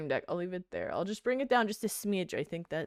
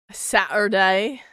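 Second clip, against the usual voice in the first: British accent; 7 dB louder, slower than usual. Shown as a demonstration. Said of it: "like, a British accent?".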